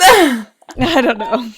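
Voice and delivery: slow words broken by laughter; laughing. Real, not acted. Laughing at her own comment.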